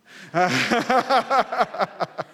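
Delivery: loud, high staccato laugh; hearty laugh. Real, not acted. Preacher laughs at having to buy another mug, hearty laugh. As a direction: burst out laughing at your own punchline. Laughs at his own punchline.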